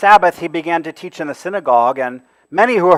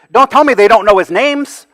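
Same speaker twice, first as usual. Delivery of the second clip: ordinary talk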